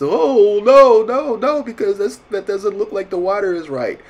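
high, loud panicked wail; mock freak-out. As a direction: imitate painters panicking, pitch and volume way up. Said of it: "a lot of people would freak out".

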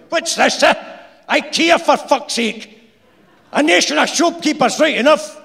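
high, loud sarcastic Glaswegian; mock them as the cocky foreman; sneering foreman. A narrator's reading. Young foreman scolding.

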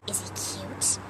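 a hushed, curious whisper; whispered question. Retold or imitated. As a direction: whisper it, breathy and eager. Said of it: "the girl goes".

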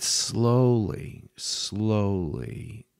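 drawn-out slowness. Real, not acted. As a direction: stretch "slowly" out at half speed. Drags the word to show slowness.

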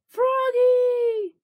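loud, high shout across the lake; calling out. Acted. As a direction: call him from far away. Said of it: "called Mister Mugwort".

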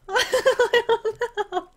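drawn out through a laugh; real helpless laugh. Real, not acted.

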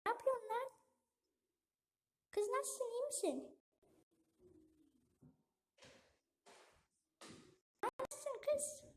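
put-on high soft falsetto girl; squeaky girly phone voice. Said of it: "Kız sesi yapacağım". (tiz kız sesi)

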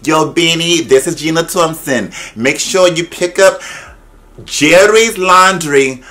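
high, slow, drawn-out speaking voice. Retold or imitated. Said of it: "how she goes from talking like".

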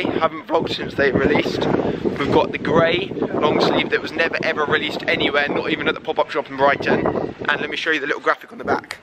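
normal talking voice

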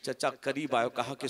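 usual speaking voice

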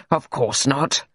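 laughing, hearty agreement; chuckle, then agree cheerily; cheery laugh. A narrator's reading. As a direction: bluff doctor's cheery laugh. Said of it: "The doctor laughed his cheery laugh".

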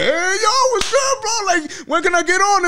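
fake-friendly high voice; mock eager fan. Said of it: "now you wanna be like".

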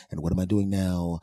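normal talking voice

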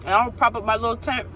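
talking with food in mouth; chewing while talking. Real, not acted. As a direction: mouth partly full. Seen 3: Bites burger at -1s, then talks with cheeks working, burger in hand.

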